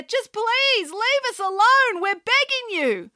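high, slow, pleading whine; mayor begging the pig to go. A narrator's reading. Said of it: "cried the mayor".

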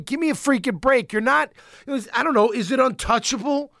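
ordinary talk

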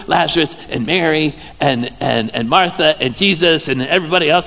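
ordinary talk

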